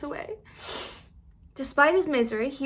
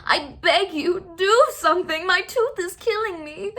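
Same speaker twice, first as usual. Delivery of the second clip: desperate begging; 7 semitones higher, 5 dB louder than usual. A narrator's reading. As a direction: beg as if in agony. Fox begging in pain.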